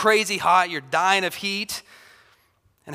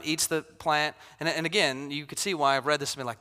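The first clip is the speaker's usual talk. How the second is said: normal talking voice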